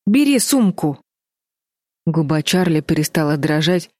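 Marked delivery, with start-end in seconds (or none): 0.1-1.0 higher-pitched urging; urgent prompting. A narrator's reading. Fliss tells Charlie to take the bag, urgently. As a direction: hurry her along, pitch up. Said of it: "поторопила Флис".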